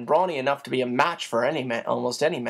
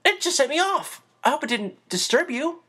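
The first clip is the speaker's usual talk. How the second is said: wild whinny; 12 semitones higher than usual. Acted. Chris excuses his laughter to the woman, high nervous. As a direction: babble the excuse in a high rush. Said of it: "he whinnied wildly".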